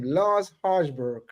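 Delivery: Lars Harsberg drawn out slowly, like a darts announcer; careful name pronunciation. Shown as a demonstration. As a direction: savour Lars Harsberg, slow and grand. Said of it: "ultimate dart player's name".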